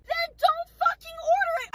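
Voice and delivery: high, loud burst of outrage; loud, high-pitched exasperation; exasperated retort. Acted. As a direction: fire back at a maddening customer. Acted angry retort to customer.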